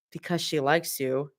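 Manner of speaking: low, plain, obvious statement; matter-of-fact. Acted. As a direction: say it like it's obvious. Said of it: "Mira replies matter-of-factly".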